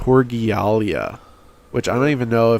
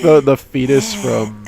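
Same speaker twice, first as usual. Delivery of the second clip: ordinary delivery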